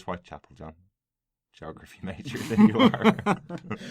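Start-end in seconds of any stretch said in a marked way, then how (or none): 1.5-3.8 laughing tease. Real, not acted. Host teases co-host.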